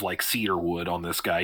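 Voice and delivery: normal talking voice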